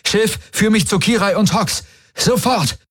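high, urgent shouted order; urgent command. Acted. Desperate order to the ship. (dringender Befehl)

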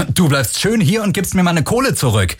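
high, heated outburst; angry demand. Acted. Angry man confronts the thief.

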